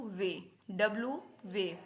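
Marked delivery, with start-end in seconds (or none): 0.0-0.4 German W named वे, v sound first; German letter W. Shown as a demonstration. Teacher drilling German W, वे as "veh".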